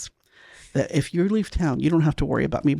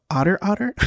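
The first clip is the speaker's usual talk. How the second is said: louder, higher stunned echo; surprised; 5 semitones higher, 4 dB louder than usual. Retold or imitated. Asking the new neighbor to repeat his name, surprised. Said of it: "I go".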